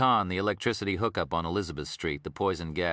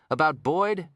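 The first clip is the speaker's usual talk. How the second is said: raised, higher cut-in question; impatient; 9 semitones higher, 4 dB louder, slower than usual. A narrator's reading. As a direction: interrupt to steer him back. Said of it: "asked impatient Lincoln Rhyme".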